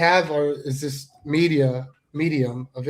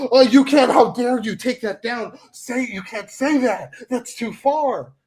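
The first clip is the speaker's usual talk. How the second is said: high, loud mock scolding that slows as it goes; imitating the outraged fans; mock outraged critic; 6 semitones higher, 5 dB louder than usual. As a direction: sneer out the critics' outrage, then drag it. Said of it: "it's like".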